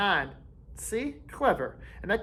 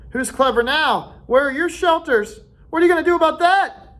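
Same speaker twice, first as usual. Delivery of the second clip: rising, louder jeering questions; triumphant taunt; 10 semitones higher, 5 dB louder than usual. A narrator's reading. Ralph jeering at Jack as the storm comes, louder. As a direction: rub it in, building up.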